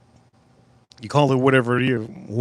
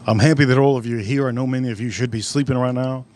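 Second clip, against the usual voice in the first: ordinary delivery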